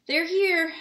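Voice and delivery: high, loud panicked cry; frantic. A narrator's reading. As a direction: blurt it out in a fluster. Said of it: "said Aunt Petunia frantically".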